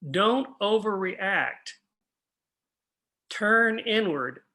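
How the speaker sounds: very slow, each word spaced out; slow, deliberate "don't overreact. Turn inward"; slow emphatic urging. Shown as a demonstration. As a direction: press it home gently but firmly. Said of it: "remember this".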